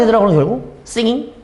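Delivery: ordinary delivery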